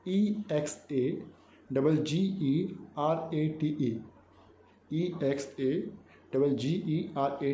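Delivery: letters of exaggerate spelt very slowly; slow spelling. Shown as a demonstration. Teacher spelling the word slowly for the class. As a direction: dictate it letter by letter. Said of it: "ये word है exaggerate".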